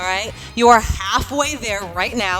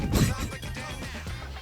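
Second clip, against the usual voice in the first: laughing; 3 semitones higher, 9 dB quieter than usual. Real, not acted. Laughs at own joke.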